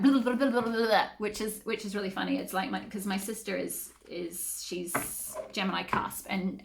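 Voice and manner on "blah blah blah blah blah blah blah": loud, higher, rattled-off gibberish; rapid babble. Shown as a demonstration. Reader mocking how fast she talks, rapid babble. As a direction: imitate your own fast talking as a babble. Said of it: "speaking really, really fast for this reading".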